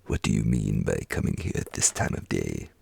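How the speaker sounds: low, mock-stern boss voice; feigned gruff Scrooge. A narrator's reading. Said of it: "in his accustomed voice, as near as he could feign it".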